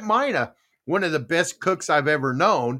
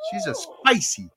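hammed-up Italian accent, higher pitched; 8 semitones higher, 7 dB louder than usual. Quoting an old commercial to the listeners, Italian accent. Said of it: "my Italian accent".

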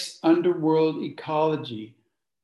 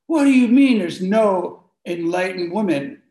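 louder, indignant retelling of the challenge; pissed off; 3 semitones higher, 7 dB louder than usual. Retold or imitated. Women confronting the Buddhist teacher, louder and indignant. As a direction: imitate a pissed-off woman confronting him. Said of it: "they're just pissed off".

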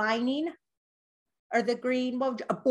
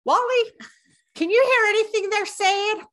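calling out much higher and louder to a deaf brother; too loud for a funeral, higher and louder; loud deaf whisper-attempt; 8 semitones higher, 7 dB louder than usual.

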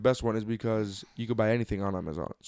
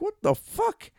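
surprised; much slower than usual. Retold or imitated. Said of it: "I'm like".

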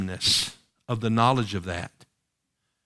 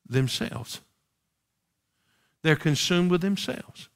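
usual speaking voice

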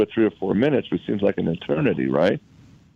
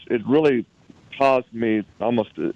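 ordinary talk, nothing marked

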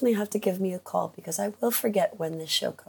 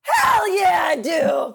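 loud, high whoop; enthusiastic yell; 9 semitones higher, 6 dB louder, much slower than usual. Real, not acted. Player eager to raise the stakes, a loud whoop. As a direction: cheer it out.

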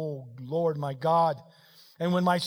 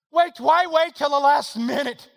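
loud, high, slow pulpit question; raised voice, drawn out and insistent; preached shout; 10 semitones higher, 11 dB louder than usual. Acted. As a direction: throw the question out forcefully to the congregation. Same question preached at full force.

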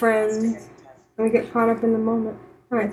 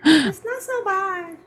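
put-on high, drawn-out little-girl voice; slow, high-pitched girlish imitation; little girl talk; 9 semitones higher, slower than usual. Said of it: "She almost had a little girl talk".

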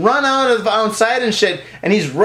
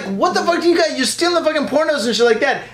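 angry yelling; 8 semitones higher than usual. Retold or imitated. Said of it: "And I'm like".

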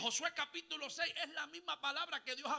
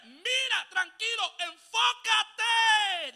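slow emphatic command; 6 semitones higher, 9 dB louder, much slower than usual. Acted. Voicing God to Joshua.

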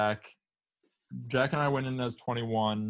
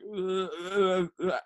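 put-on drunken mumbling; 7 semitones higher, much slower than usual. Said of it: "he just kept going, like".

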